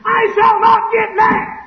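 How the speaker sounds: slow, high-pitched full scream; hollering scream. Acted. Man with a temper finally snaps, hollering and screaming. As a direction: lose control and scream every word. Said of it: "he finally hollers and screams".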